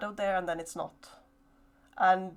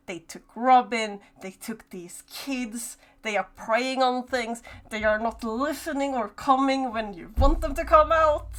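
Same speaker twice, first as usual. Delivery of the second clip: angry; 4 semitones higher, 8 dB louder than usual. Acted. GM voicing creature anger.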